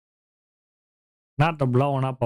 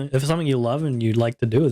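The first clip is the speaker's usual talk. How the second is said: ordinary talk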